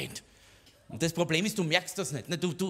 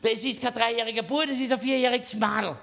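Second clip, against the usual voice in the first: indignant correction; 7 semitones higher, 4 dB louder than usual. Retold or imitated. Father corrects grannies.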